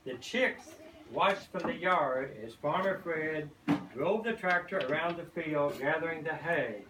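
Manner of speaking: plain reading voice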